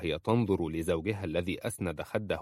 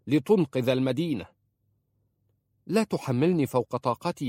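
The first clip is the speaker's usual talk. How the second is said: raised, heated reproach, building louder at the end; harsh scolding; 4 semitones higher, 5 dB louder than usual. A narrator's reading. Uncle scolds niece for trusting her husband, harsh. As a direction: berate her with rising force.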